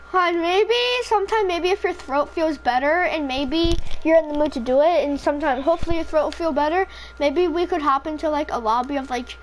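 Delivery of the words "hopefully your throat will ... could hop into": ordinary talk, nothing marked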